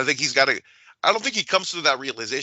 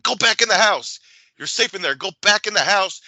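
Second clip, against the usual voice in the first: higher, urgent mock plea to go back inside; urgent warning; 7 semitones higher, 5 dB louder than usual. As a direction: hurry her back in, half-acted. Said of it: "is that it goes like".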